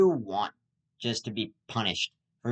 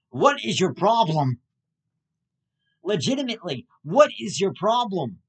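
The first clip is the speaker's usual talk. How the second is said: raised, incredulous demand; louder exasperated confronting question; exasperated road rage; 5 semitones higher, 6 dB louder than usual. Acted. Angry at a driver who cut in front, exasperated.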